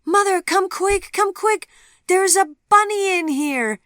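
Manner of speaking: put-on very high, loud little-girl shriek; top-of-lungs child shriek; child's shriek. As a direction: scream for mother, thrilled. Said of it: "shrieked Sophie at the top of her small lungs".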